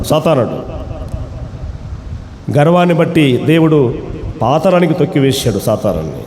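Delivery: usual speaking voice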